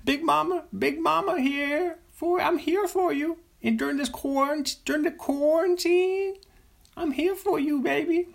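very high falsetto, cooing Big Mama; high falsetto mama, promising she's here for you; Big Mama impression. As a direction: croon comfort like a big mama. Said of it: "That's my impression of his character".

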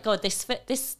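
usual speaking voice

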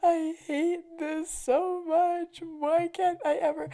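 high, slow, wailing mock sob; imitated bawling. Pulled over lost in the car, imitated sobbing. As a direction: whine it out like crying in the car. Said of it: "literally bawled my eyes out".

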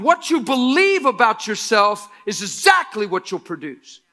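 loud, high, fervent preacher's cry; preaching at full force. Acted. Pastor to the church, building the sermon to a roar. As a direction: drive the point home, full voice, stretching key words.

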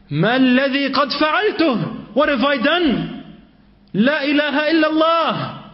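high, pained cry of guilt; a young man crying out in shock at himself; mock anguished yell. Young man crying out at his own sin, high anguished cry. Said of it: "he yelled so loud".

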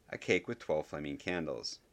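plain reading voice

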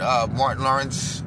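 ordinary talk

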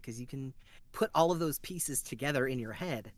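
ordinary talk, nothing marked

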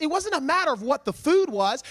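ordinary delivery

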